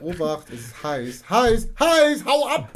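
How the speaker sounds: hot hot hot, rising to a high yelp; walking on hot sand. Pretend. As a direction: hop about as if the ground burns. Warning app voiced as hot alert.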